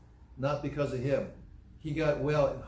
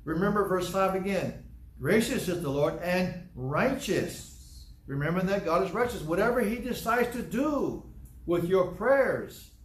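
fervent sermon delivery; fervent preaching; 5 semitones higher than usual. Acted. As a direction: drive the point home to the congregation. Preaching at full force.

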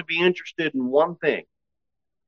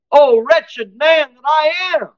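high, loud and slow, a soldier's anguished call; warrior's cry; 9 semitones higher, 3 dB louder, slower than usual. Acted. Preacher voicing Paul as a warrior crying out. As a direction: cry it out like a fighter wounded in battle.

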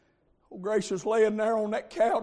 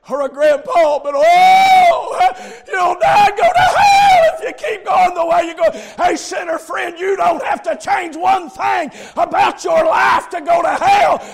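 high, loud revival preaching; full-throated, urgent sermon shout; preaching at full force; 12 semitones higher, 16 dB louder than usual. Real, not acted. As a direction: shout the warning at the sinner.

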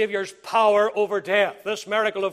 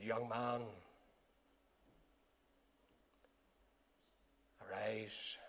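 soft, low arise; hushed command; 11 semitones lower, 23 dB quieter, slower than usual. Acted. Christ quietly calling the dead son to rise.

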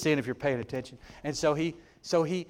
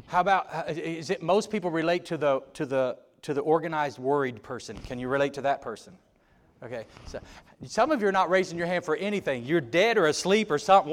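normal talking voice

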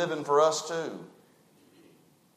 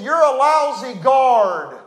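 scolding; 7 semitones higher, 7 dB louder, slower than usual. Retold or imitated. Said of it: "I'm gonna paraphrase it".

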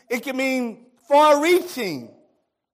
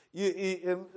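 usual speaking voice

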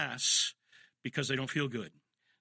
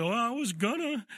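mock whiny child; 9 semitones higher than usual. Child excusing delayed obedience.

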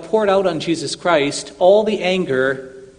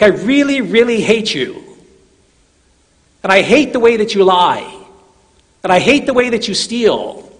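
loud, higher furious preaching of God's hatred; angry God voicing; 4 semitones higher, 6 dB louder than usual. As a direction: hurl each I hate at the listener, louder. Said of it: "God says to him, he says".

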